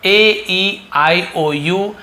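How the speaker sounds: each vowel drawn out slowly; slow vowel recital. Shown as a demonstration. Teacher slowly names the vowels for beginner students. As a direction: spell out the five vowels slowly, one by one. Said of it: "आज हम यहाँ पे देखने वाले हैं".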